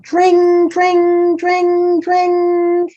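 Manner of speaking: high, loud ringing dring dring; ringing bell noise. Pretend. Reading to a child, imitating the engine's bell. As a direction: ring it out like a fire bell.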